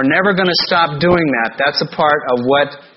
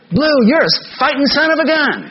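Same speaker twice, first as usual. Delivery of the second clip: put-on much higher voice of an impressed teen; admiring buddy; 12 semitones higher than usual. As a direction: praise him like a buddy cheering a winner. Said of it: "One guy looked at me and said".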